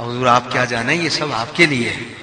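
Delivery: low, soft, deferential reply; gently honour the guest with a dropped voice; humble, softened tone; reverent reading. Acted. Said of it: "بات وہی ہے".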